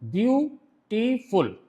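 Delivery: "Beautiful" slowly in three separate parts, view, टी, फुल; teacher sounding out "beautiful" syllable by syllable, very slowly; split into syllables. Shown as a demonstration. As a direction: break "beautiful" into view… टी… फुल, pausing between each. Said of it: "ये dot लगा".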